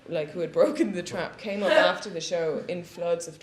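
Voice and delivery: usual speaking voice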